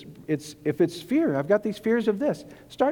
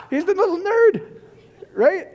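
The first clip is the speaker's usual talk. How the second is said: higher, laughing through the line about the little nerd; laughing mockery; 11 semitones higher, 3 dB louder, slower than usual. Real, not acted. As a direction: giggle as you tease the little nerd, a bit slow. Mocking the kid, laughing.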